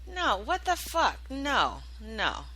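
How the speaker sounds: high, emphatic no, fading at the end; firm refusal. Retold or imitated. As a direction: reject it outright, then let it trail off. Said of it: "And this time I was like".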